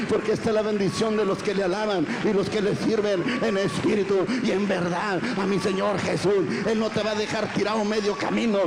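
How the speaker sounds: preaching. Acted. Preacher exhorting the congregation to praise the Lord.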